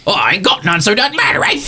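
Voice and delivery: put-on cranky old crone yelling through the dust; tottery old lady. Shack's old lady shouting at the stranger at her door, high and cranky. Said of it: "this tottery old lady".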